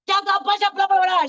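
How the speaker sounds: high, loud gibberish rant; a loud mock telling-off in gibberish; gibberish scolding. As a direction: scold her in nonsense syllables.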